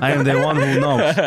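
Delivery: a mock Cuban Heisenberg boast through laughter. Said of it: "Cuban Heisenberg right now".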